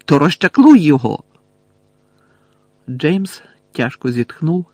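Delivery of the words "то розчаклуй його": angry demand. A narrator's reading. Lily angrily demands.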